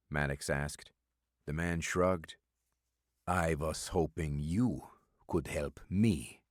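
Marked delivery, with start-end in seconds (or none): 3.3-6.4 put-on slow, thick Eastern European accent, words clipped short; a stranger testing the waters in a heavy Eastern European accent. Stranger to Maddox in a diner, slow thick Eastern European accent. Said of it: "he said in a thick, clipped accent".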